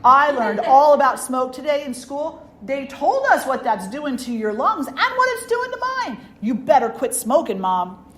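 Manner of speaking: high, loud scolding schoolkid; insistent kid's telling-off, pushed loud; serious little boy. Retold or imitated. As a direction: imitate a child sternly lecturing a parent. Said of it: "he was all serious and he went".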